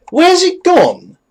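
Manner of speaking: high, slow, baffled whine; drawn-out baffled whine. Real, not acted. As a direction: stretch it out in disbelief. Spell vanished from list.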